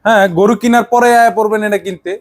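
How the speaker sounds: normal talking voice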